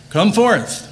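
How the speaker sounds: high, ringing call to rise from the dead; commanding call of Jesus. Acted. Preacher voicing Jesus calling the dead, ringing command.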